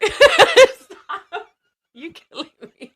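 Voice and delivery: laughing. Real, not acted. Overcome by laughter at a joke.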